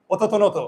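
"অততনত" run on as o-to-to-no-to; pronunciation without hasanta. Shown as a demonstration. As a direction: put a vowel after every consonant in "অততনত". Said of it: "যদি তুমি হসন্ত না দাও". ("অততনত" হসন্ত ছাড়া, না থেমে)

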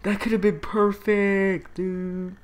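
high, loud exasperated disbelief; incredulous outrage. Real, not acted. Player reacting to the missed kiss, loud incredulous dismay. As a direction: stretch out the dismay at a high pitch.

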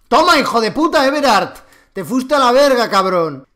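loud, high taunting yell; gloating shout. Real, not acted. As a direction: taunt the player you just shot. Taunting killed enemy.

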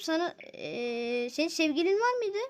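ordinary talk

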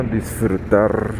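ordinary delivery

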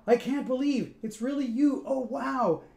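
high, thrilled fan voice; starstruck. Acted. Sprock meets his idol, high starstruck gush. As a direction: gush like meeting a hero. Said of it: "cried Sprock".

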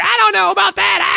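mock a woman yelling into speakerphone; imitated yelling. Woman on speakerphone on a packed bus, mock yelling. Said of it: "And she's yelling".

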